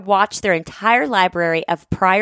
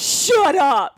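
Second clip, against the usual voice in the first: delighted disbelief; 4 semitones higher than usual. Real, not acted. Disbelief at top one percent.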